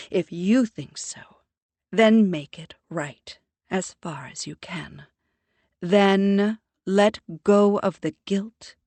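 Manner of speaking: a mother lecturing with prim self-assurance; proud mother. A narrator's reading.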